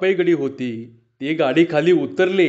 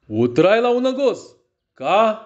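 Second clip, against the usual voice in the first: high-pitched, questioning echo of उतराय लावू नगोस; puzzled questioning; 5 semitones higher than usual. A narrator's reading. Robber failing to understand the woman's plea, puzzled questioning. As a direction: throw नगोस back as a baffled question.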